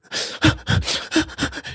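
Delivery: high, breathy imitation of sobbing; mock sobbing gasps. Driver imitating his crying passenger, mock sobbing gasps. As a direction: gasp in bursts like someone crying.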